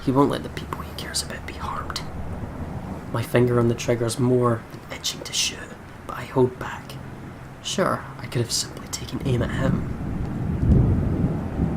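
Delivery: hushed, breathy narration of his own thoughts; a gunman whispering his thoughts as he holds back; whispered inner monologue. Acted. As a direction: whisper it like a secret to yourself.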